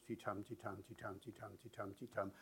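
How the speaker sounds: soft ti-TUM beat, weak then strong; stress-timed rhythm. Shown as a demonstration. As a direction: quietly tap out ti-TUM in pairs. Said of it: "That's traditional British English".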